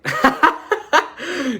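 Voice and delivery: high burst of laughter; amused high laugh. Real, not acted. Laughing at his own Watergate gag, amused laugh. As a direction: crack up at the joke.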